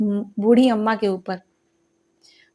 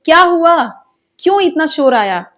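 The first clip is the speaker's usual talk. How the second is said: called out; 5 semitones higher than usual. A narrator's reading. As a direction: shout the question across the house in alarm. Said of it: "बूढ़ी अम्मा ने चिल्लाया".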